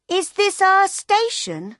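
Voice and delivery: put-on high, loud child's question; excited little girl. A narrator's reading. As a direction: squeal it like a eager kid.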